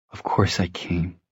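soft, slow, hushed reply; tender reassurance. A narrator's reading. Tessa reassuring Jem as they face each other, tender and hushed. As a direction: answer gently and slowly. Tender reunion in music room.

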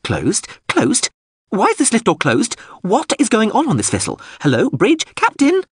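very high, building drone outrage; indignant synthesized voice. Acted. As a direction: start puzzled, climb quickly into shrill demands. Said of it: "the synthesized voice went on".

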